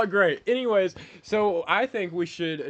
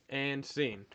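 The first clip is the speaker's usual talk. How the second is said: ordinary talk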